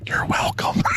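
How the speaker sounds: unvoiced mock whisper. Dad whispering to the camera, a playful whisper. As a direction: breathe it like a secret to the camera. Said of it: "He then whispers to the camera".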